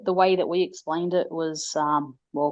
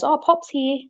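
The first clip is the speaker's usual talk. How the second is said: put-on higher, bright child's announcement; toddler announcing; 8 semitones higher, slower than usual. Little girl casually announcing great-granddad's ghost, high child voice. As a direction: say it like it's nothing unusual. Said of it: "she goes".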